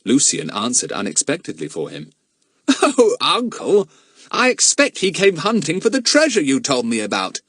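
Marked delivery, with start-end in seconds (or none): 2.7-7.4 put-on very high, louder boyish voice; silly nephew. Lucian answering unexpectedly to his uncle, high and naive. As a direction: pipe up eagerly in a high voice.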